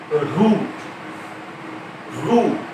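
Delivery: रूट with a long rounded ऊ; long oo in root. Shown as a demonstration. Teacher to class, modelling रूट with long ऊ. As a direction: hold the ऊ in रूट. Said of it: "जैसे है".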